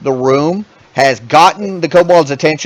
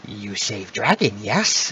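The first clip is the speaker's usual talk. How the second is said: softer, lower, intent kobold queen; low quiet queen voice; 6 semitones lower, 10 dB quieter, slower than usual. Acted. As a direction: lock eyes and ask it low. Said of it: "looks Narama dead in the eyes and goes".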